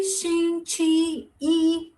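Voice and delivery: 星期一 very slow, high held syllables; careful demonstration. Shown as a demonstration. Teacher recaps Monday for class, slow careful demonstration. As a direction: stretch each syllable of 星期一 evenly. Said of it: "if Monday".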